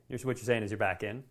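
normal talking voice

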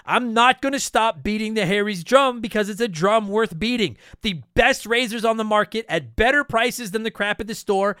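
loud, punchy sponsor read. Acted. Podcast host reading his sponsor ad, upbeat and punchy. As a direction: sell it like a pitchman.

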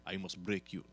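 put-on low, quiet Russian accent. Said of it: "let me do Russian".

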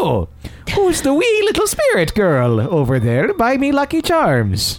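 high-pitched, hammed-up fake Irish leprechaun brogue; bad Lucky Charms accent. Shown as a demonstration. Host shows off a bad Scottish accent, Lucky Charms Irish brogue. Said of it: "gonna sound like the Lucky Charms".

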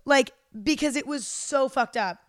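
gasping, half-crying mock sob; a hint of real tears breaking through; reliving the sobbing. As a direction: choke up as you retell the crying. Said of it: "Bawling my eyes out".

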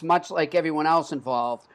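normal talking voice

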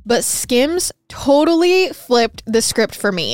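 upbeat, loud sponsor read. Acted. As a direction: sell it like a hosted podcast ad.

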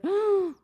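sharp high gasp; startled gasp. Retold or imitated. Walker startled by a stopping truck, sharp gasp. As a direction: gasp as if a stranger pulled up. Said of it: "my first instinct is to be like".

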